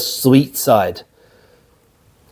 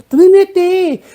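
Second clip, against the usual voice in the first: high, loud, drawn-out squeal on trinity; a comic high-pitched squeal of trinity; squealed word; 4 dB louder, slower than usual. Acted. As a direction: shriek trinity way up high like a punchline. Comic exaggerated pronunciation.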